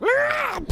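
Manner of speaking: sudden high, loud yelp; mock pain yell. Friend imagining hitting a helicopter blade, mock yell. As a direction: act out a short cry of pain.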